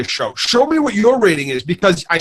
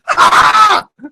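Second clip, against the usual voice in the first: shouted, high mocking cackle of a trashy show; mock gleeful cackle; 11 semitones higher, 5 dB louder, slower than usual. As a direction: cackle loudly as if the show laughs at you. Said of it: "Z Nation is a great show because it says".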